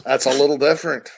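ordinary delivery

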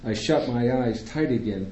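ordinary delivery, nothing marked

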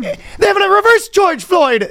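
very high, slow hammed-up Irish; drawn-out, high mock Irish accent; bad Irish accent. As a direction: wail it in bad falsetto Irish. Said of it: "That's a bad Irish accent".

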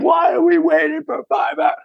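high-pitched imitation of pissed-off customers; hammed-up irate shopper; mock indignant complaint. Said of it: "these Jamaican women come in and pissed off".

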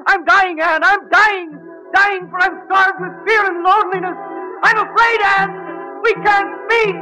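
high, desperate dying cry; rising panic, pleading; overacted death scene.

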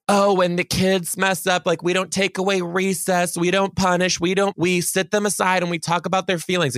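high-pitched mock school-policy voice; mocking imitation. Teacher mimicking a school that won't punish, mocking. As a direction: parody the lenient school line.

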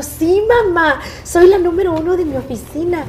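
high, bright boast of being number one; proud daughter. Retold or imitated. Daughter bragging to her mother about being the top seller, high and proud. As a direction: crow proudly to mom.

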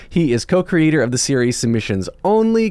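ordinary talk

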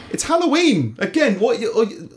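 high-pitched exasperated protest; high, incredulous objection. Real, not acted. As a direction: protest it in disbelief, voice shooting up. Exasperated at costume rules.

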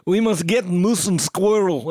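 mock Russian accent, drawn out; broad Russian accent. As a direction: imitate a cartoon spy's thick Russian accent. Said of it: "big, broad Russian accent she's doing".

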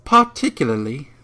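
put-on British accent. Shown as a demonstration. Host finding the word easier, British accent. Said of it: "easier in a British accent".

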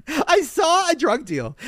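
high, slow, stunned delivery; shocked disbelief. Real, not acted. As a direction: spell out your shock slowly. Shocked about drug deal.

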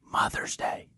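hushed, urgent whisper. Retold or imitated. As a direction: whisper it so nobody wakes. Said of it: "Why are we whispering?".

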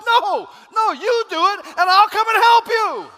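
high, loud declaration as God; shouted sermon voice; shouted preaching. Acted. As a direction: thunder God's answer at them. God's reply shouted in sermon.

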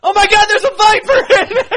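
high, loud laughing fake scream; mock panic shriek. Scout kid finding a viper in his friend's bag, mock shriek. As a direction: shriek as if a snake jumped out. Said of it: "pretend scene with Logan".

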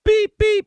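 high squeaky beep beep standing in for a swear; mock censor beep. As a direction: bleep yourself out like a TV censor. Self-censoring a swear word.

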